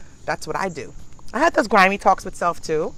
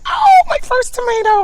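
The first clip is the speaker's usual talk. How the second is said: much higher, louder thrilled cry; excited shout; 12 dB louder than usual. Retold or imitated. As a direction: relive the joy of finding it. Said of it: "and I'm like".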